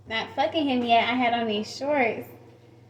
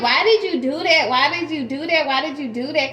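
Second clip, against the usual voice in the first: upset demand; 3 semitones higher, 6 dB louder than usual. Retold or imitated. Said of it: "and I'm like".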